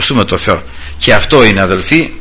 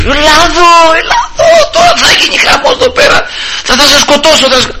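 very high, strained mock yelling; furious high-pitched rant, acted out; imitated angry shouting; 14 semitones higher than usual. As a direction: play a man yelling threats at a worker. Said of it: "άρχισε να φωνάζει".

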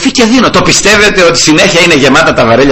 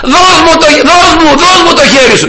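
urgent pleading; 13 semitones higher than usual. Retold or imitated. Rescuer pleading with drowning man.

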